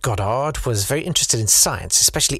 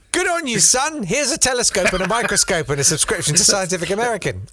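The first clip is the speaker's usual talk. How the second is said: cheery proud dad; 8 semitones higher than usual. Retold or imitated. Dad voiced in a story.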